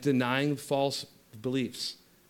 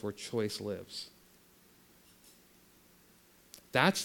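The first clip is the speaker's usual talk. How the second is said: guided meditation; 3 semitones lower, 5 dB quieter than usual. Acted. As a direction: lead them gently inward.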